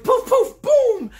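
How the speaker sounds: high, loud "poof, poof, boom"; imitated explosion noises. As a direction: voice the blasts like cartoon magic. Imitating magic noises in retelling.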